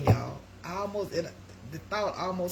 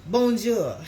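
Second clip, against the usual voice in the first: showing off French greeting; 4 semitones higher, 8 dB louder than usual. Shown as a demonstration. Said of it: "I took French".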